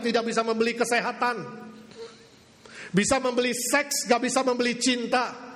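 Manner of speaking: full-force preaching. Acted. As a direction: drive each contrast home.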